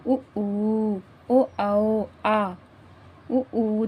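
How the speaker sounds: उ, ऊ, ओ, आओ said slowly with lips pushed round; teacher shapes each vowel with rounded lips, drawn out; बोलिए: उ ऊ ओ with lips made round, each vowel held; rounded-lip vowel demo. Shown as a demonstration. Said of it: "होठ से बोलिए".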